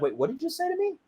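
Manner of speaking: high, softened, incredulous question; double-check what was just heard, voice lifted; stunned disbelief. Retold or imitated. Mom on the phone hearing the news, stunned.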